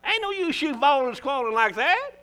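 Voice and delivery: sister, calm down, half-playful; preacher scolding a weeping lady. Retold or imitated. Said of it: "I said".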